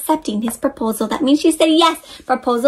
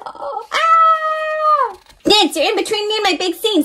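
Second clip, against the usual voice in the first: high character voice; 9 semitones higher than usual. Acted. Character alarmed.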